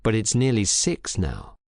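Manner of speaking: ordinary delivery, nothing marked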